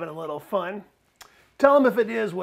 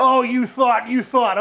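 raised, louder sarcastic jab; loud, scornful ribbing; mocking retort; 3 semitones higher, 7 dB louder than usual. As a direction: throw their words back mockingly. Mocking the other's "I thought.

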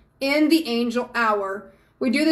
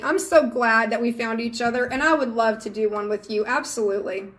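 ordinary delivery

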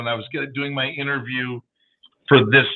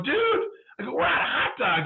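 high-pitched frantic "dude"; mock-frantic cry for help; retold panic; 12 semitones higher than usual. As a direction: act out a panicked complaint, voice jumping up. Retold panic, out of hot dogs.

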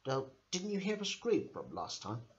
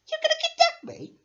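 much higher and louder panicked question; terrified squeal; 8 dB louder than usual. Acted. Captive toy repeating the threat, panicked squeal. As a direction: shriek it in alarm.

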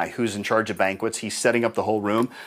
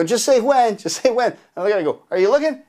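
high, louder push for an answer; impatient calling out; 10 semitones higher, 4 dB louder than usual. Retold or imitated. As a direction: prod a distracted helper.

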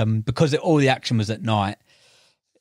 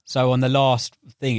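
ordinary talk, nothing marked

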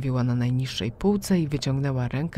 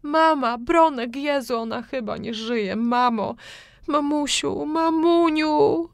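high, loud staged cry for help; fake scream for help; 12 semitones higher, 4 dB louder, slower than usual. A narrator's reading. Daughter fakes panic over mother's body, screaming high. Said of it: "wydarłam się na cały głos".

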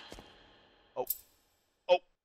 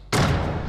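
sharp gasp; 5 dB louder than usual. Real, not acted. Sudden scare in game.

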